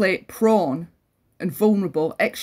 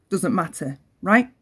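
ordinary talk, nothing marked

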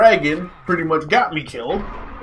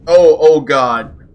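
ordinary talk, nothing marked

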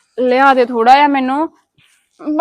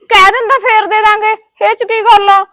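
higher, louder indignant snap; angry outburst; 8 semitones higher, 4 dB louder than usual. Acted. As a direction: protest angrily at the husband. Wife angry at refused credit.

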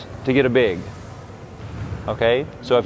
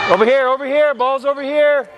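calling from far away; 11 semitones higher than usual. Real, not acted. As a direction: call out to the far court. Coach calling across the gym.

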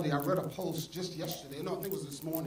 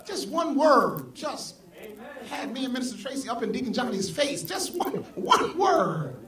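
much higher, loud and slow, bursting with excitement; excited; 6 semitones higher, 6 dB louder than usual. Real, not acted. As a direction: relive the thrill of that one word. Excited retelling of a Bible game.

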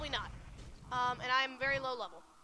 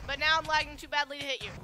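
normal talking voice

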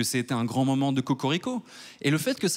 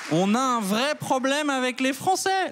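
mock outrage; 11 semitones higher, slower than usual. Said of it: "mock outrage about the French".

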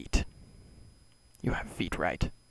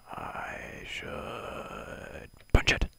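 drawn out; 4 semitones higher, much slower than usual. Acted. Same line, drawn out whispered.

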